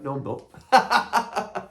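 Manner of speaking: slow, amused Scouse denial; Mark Loftus Scouse. Retold or imitated. Father-in-law denies being her fan, Scouse accent. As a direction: drawl it out Scouse, laughing through it. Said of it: "in his little Scouse voice".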